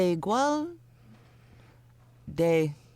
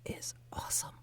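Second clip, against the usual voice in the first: whispered brag; soft conspiratorial whisper; 16 dB quieter than usual. Acted. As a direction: hush it like a secret boast. Confiding joke about her accent.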